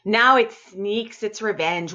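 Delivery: ordinary delivery, nothing marked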